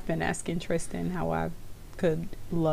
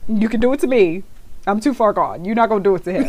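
mock protective scold; 4 semitones higher, 6 dB louder, faster than usual.

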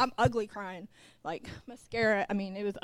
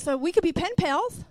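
higher, louder mock boyfriend voice; mocking his voice; 6 semitones higher, 6 dB louder than usual. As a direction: parrot his naive suggestion. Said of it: "And he says".

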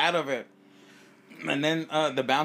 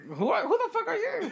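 mock baffled retort. Retold puzzled reply, laughing.

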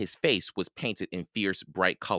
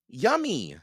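put-on high, relishing child; delighted tasting; 9 semitones higher than usual. A narrator's reading. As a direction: exclaim it high over something delicious. Girl tastes honey in story.

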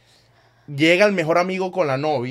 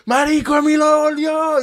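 high, slow, loud cheering call; excited call; 7 dB louder, slower than usual. Retold or imitated. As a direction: call out his return like a party crowd. Friends cheering his return.

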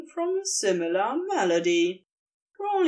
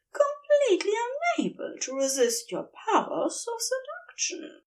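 a lightly voiced, higher playful angel; teasing angel; 12 semitones higher than usual. A narrator's reading. Angel flattering his sulking demon lover, lightly voiced higher tease. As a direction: tease him with mock helplessness.

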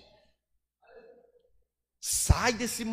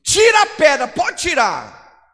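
huge, higher shout, slowed on the first words; commanding shout; 7 semitones higher, 12 dB louder than usual. Acted. Preacher voicing Jesus at the tomb, commanding shout. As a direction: bellow the command to the crowd.

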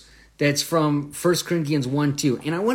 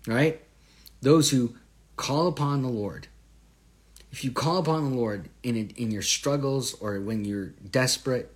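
ordinary delivery

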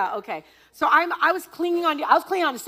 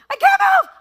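very high, very loud panicked cry; frozen panic; 12 dB louder than usual. Retold or imitated. Frozen on the haunted house stairs, crying out in panic. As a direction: cry out stuck with fear. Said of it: "I'm like".

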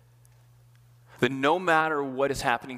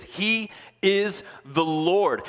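slow, higher emphatic declaration; 5 semitones higher, much slower than usual. Real, not acted. Preacher declaring to the congregation, slow and emphatic. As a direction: proclaim each word.

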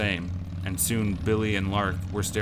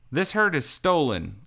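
higher, sharp accusation; angry hiss; 8 semitones higher, 4 dB louder than usual. A narrator's reading. Lark accuses the outlaws, hissing angrily. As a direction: bite out the charge. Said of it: "Lark hissed".

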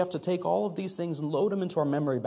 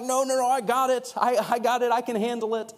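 higher, quick-fire refusal; brushing off help; 7 semitones higher, 4 dB louder than usual. Acted. Acting someone refusing help with a load, brushing it off. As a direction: wave away the offer, voice lifted. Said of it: "they end up getting a little testy".

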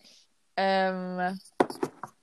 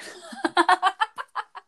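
high giggle; 9 semitones higher than usual. Real, not acted. Laughs at own question.

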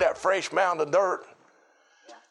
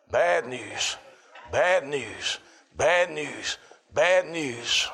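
grave repeat; 5 semitones lower, much slower than usual. Real, not acted. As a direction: let each bad news drop heavily. Repeated messengers' news.

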